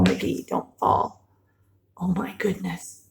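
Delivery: hushed worried plea; whisper. Pretend. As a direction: beg him softly, breathless. Pleading with character in story.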